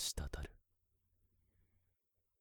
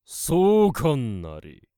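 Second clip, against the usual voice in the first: slow, loud gloating sorcerer; booming commanding warlord; 6 semitones higher, 10 dB louder, much slower than usual. Acted. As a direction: proclaim it from the tower top. Wizard gloats over battle.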